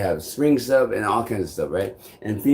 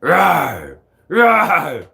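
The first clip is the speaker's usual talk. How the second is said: loud, higher roaring growl; 7 semitones higher, 7 dB louder than usual. Retold or imitated. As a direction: roar it like a silly monster. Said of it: "trouble with these stupid voices".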